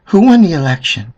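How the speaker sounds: put-on sing-song baby talk to a dog. Owner coaxing the dog to answer, high coaxing voice. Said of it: "look at your dog and pet and say".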